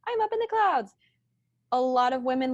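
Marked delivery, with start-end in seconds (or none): 0.1-0.9 high, floaty head voice; very high pitched. Shown as a demonstration. Demoing the airy voice women are taught. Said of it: "It's very high pitched".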